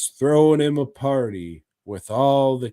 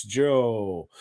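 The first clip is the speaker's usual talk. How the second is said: usual speaking voice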